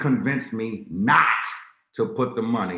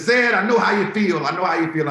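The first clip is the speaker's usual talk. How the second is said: voiced broker; 9 semitones higher, 7 dB louder than usual. Broker's sympathetic reply voiced.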